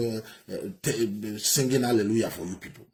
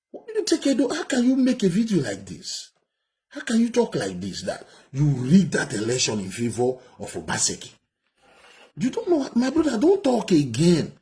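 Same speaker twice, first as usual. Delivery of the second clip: furious high scolding; 6 semitones higher, 3 dB louder than usual. Real, not acted. Commentator scolds the politician in the clip.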